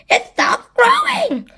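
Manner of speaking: high, drawn-out loud cry; high-pitched excited squawk. A narrator's reading. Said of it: "as Spiker cried".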